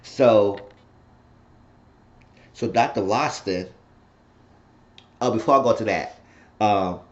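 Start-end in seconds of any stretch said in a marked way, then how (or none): none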